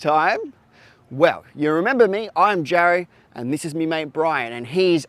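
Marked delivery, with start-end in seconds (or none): none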